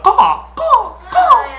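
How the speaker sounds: high cawing crow call. Retold or imitated. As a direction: caw it out like a crow. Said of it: "If a crow had a cough, he'd be like".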